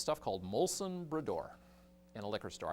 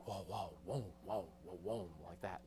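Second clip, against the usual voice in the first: soft fading whoa sounds; echo imitation; 5 semitones lower, 11 dB quieter than usual. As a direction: let it fade like distant echoes. Imitating echoes of distant guns.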